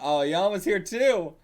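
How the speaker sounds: loud, high, drawn-out call. Acted.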